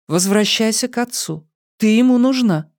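high, gentle motherly urging; high-pitched mother's counsel. A narrator's reading. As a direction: send her home in a lifted voice. Mother advises daughter.

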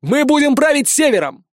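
high, ringing leader's shout; yelled rallying cry. A narrator's reading. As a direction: proclaim it to the whole crowd. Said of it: "заорал я".

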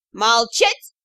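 short, stern order; barked command. A narrator's reading. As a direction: bark it to silence everyone. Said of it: "рявкнула матушка Юрао". (властный окрик)

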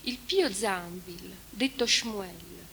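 a mournful recitation of the Trento lament; solemn reading of a lament. Acted. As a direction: read it like a mourning poem. Reads the lament translation aloud.

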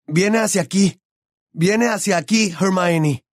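high-pitched warning cry; urgent shout. A narrator's reading. Boy warns his friend of what approaches, urgent shout. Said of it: "Mientras Harry gritaba".

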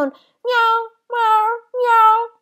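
high, drawn-out loud mewing; kitten meows. A narrator's reading. Kittens interrupting Heidi's excuse with high meows. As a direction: mew insistently like kittens. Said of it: "Come ti viene in mente di miagolare".

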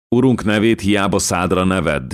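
usual speaking voice

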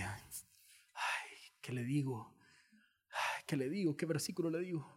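mitad susurro, nervioso; creyente apurado. Retold or imitated. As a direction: como quien no sabe qué responder. Creyente confundido ante la tentación. (el creyente ante Satanás, susurro apurado)

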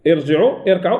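ordinary talk

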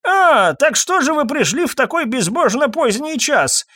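put-on high, fussy elderly voice; put-on aged complaint, pitched right up; querulous old man. A narrator's reading. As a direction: grumble in a high old man's voice. Old pharmacist grumbles at late callers.

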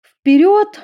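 very high loud rallying call; commanding call. A narrator's reading. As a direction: point and call them forward. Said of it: "указала на дверь Эдисон".

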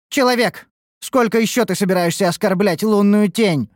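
high-pitched, offended outburst; hot-tempered, indignant challenge; flaring up. A narrator's reading. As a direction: flare up at the insult. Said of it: "вспылила Амаранте".

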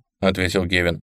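usual speaking voice

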